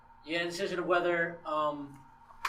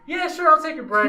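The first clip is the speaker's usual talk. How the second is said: eager guard; 8 semitones higher, 7 dB louder than usual. Retold or imitated. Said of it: "he's like".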